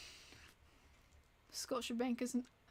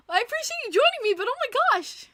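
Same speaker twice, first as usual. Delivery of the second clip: much higher and louder, fast and gushing; excited exclaim; 11 semitones higher, 10 dB louder than usual. Real, not acted. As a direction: gush with amused disbelief. Laughing disbelief at late viewer.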